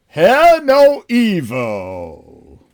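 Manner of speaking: dramatic announcer voice. Acted. Dramatic title read.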